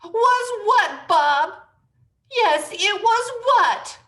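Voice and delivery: high loud demand. Acted. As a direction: press him, loud and high.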